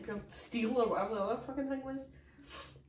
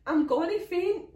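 high, loud, slow panicked wail; 10 semitones higher, 12 dB louder, slower than usual. Retold or imitated. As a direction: wail that you're about to faint. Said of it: "I was like".

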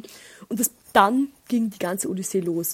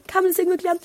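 same question again, pitched much higher and outraged; exasperated; 10 semitones higher, 4 dB louder, much faster than usual. Real, not acted. Asking her viewers again about the toilets, much higher and incredulous. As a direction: push the repeat way up in disbelief. Repeated rhetorical complaint.

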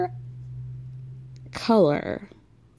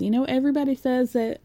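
put-on lightly done Southern accent; 4 semitones higher, faster than usual. Vlogger quoting Lindsay about jail makeup, hint of Southern accent. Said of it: "I'm doing a Southern accent".

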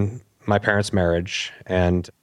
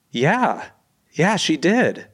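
amazed confirmation; 7 semitones higher, much slower than usual. Retold or imitated. Half brother's amazed confirmation.

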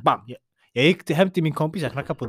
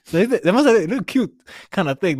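high, louder excited retelling; bursting with it, pitched up; high, louder excited storytelling; 9 semitones higher, 6 dB louder than usual. Real, not acted. Spider finally jumps out.

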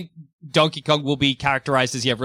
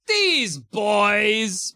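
put-on exasperated sensible girl; 5 semitones higher than usual. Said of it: "sensible girl who's like".

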